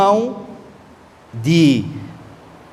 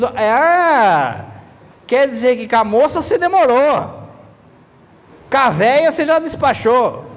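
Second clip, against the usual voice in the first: raised, mock jealous wife's jab; jealous priest's wife; 5 semitones higher than usual. Priest's wife teases husband about the pretty girl, mock jealous. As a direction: needle him like a suspicious wife. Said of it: "A mulher do padre só diz".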